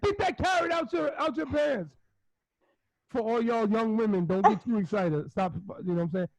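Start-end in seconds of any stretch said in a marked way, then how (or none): 0.0-2.0 wife scolding. Retold or imitated. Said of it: "she said".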